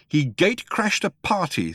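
mild anger. A narrator's reading. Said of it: "trembling with anger".

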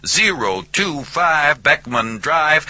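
high Southern voice, playfully announcing; speakerphone recital. A narrator's reading. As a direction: recite it for the meter down a tinny line.

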